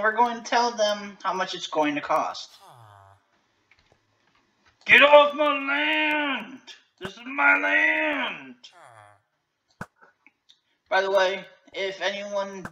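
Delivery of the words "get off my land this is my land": slow, high, hammed-up shout; wild rambling cackles. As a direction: bellow like a cranky landowner. Mock angry landowner shouting.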